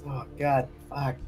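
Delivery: ordinary talk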